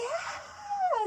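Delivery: long, very high held "ah"; retold wailing cry. Owner showing how she only cried, high imitated wail. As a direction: imitate a wail of grief without full volume. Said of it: "así, porque yo solo lloraba".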